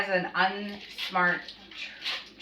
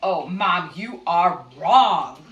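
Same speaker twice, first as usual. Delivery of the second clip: drawn-out mock know-it-all, louder on the end; imitating son; 3 semitones lower, 5 dB louder, much slower than usual. Mom mimics son telling her she is wrong, drawn-out mock certainty. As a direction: stretch it out like a kid correcting a parent. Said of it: "am I portraying this pretty right?".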